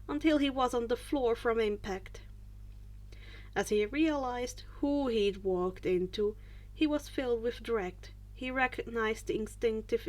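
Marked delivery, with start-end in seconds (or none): none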